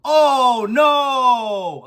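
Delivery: dismayed cry. Retold or imitated. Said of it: "She goes".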